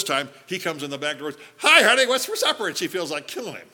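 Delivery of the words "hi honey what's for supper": high, loud breezy greeting; cheery oblivious husband. Retold or imitated. Husband breezes in the back door to his worn-out wife, high and cheery. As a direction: bounce in carefree and chipper.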